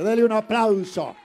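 loud, high call for applause; full-force pulpit hype; preacher's rally call. Acted. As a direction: rouse the room. Calling congregation to applaud.

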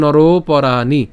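slow নর পরাণি, "ro" held long; teacher's stretched recitation of নর পরাণি; drawn-out joined pronunciation. Shown as a demonstration. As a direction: pull the end of নর into পরাণি. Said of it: "টেনে উচ্চারণ".

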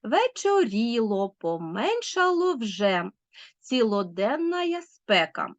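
slow triple beat landing on the third syllable of "вечоріло"; anapaestic scansion. Shown as a demonstration. Teacher demonstrating anapaest, slow stress on third syllables of "Цілоденна". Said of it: "з наголосом на третьому".

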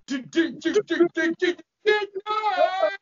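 very high, loud stuttering squeal; panicked high-pitched pig voice; pig-faced demons. A narrator's reading. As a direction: stammer it in a piggy shriek. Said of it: "Two pig-faced demons look up and say".

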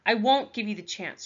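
usual speaking voice

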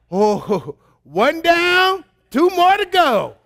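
high, loud mock celebration; hammed-up excited dad; man talk. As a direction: cheer like a husband counting down the kids. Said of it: "And that's how we talk".

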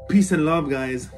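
ordinary delivery

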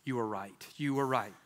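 low hushed affirmation; God gently telling him he was right, low and soft; soft, deep God voice. Pretend. Preacher imagining God in heaven saying it, low and quiet. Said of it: "God's gonna look at me and go".

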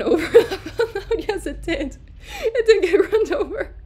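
breaking into helpless laughter, pitch shooting up; laughing. Real, not acted. Lecturer cracking up at the run-over peacock, laughing.

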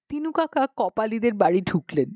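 ordinary delivery, nothing marked

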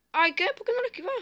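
sudden high frustrated outburst; shocked he got hit; frustrated disbelief. Real, not acted. Player hit, upset at himself.

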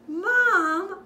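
loud, high call to mom; yelled. Acted. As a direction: shout it out like an excited kid. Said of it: "I yelled".

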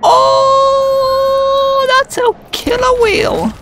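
high alarmed cry. Real, not acted. Spots killer whale.

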